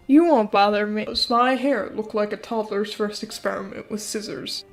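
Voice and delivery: ordinary delivery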